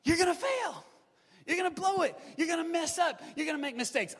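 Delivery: high-pitched preacher's refrain; emphatic preaching. Real, not acted. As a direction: hammer each short sentence home to the congregation.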